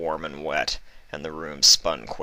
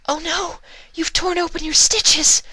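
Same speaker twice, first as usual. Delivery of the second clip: high, loud panicked screech; Fluttershy screeching in panic at the bleeding stitches; 7 dB louder than usual. Acted. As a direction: shriek it in alarm. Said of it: "Fluttershy screeched".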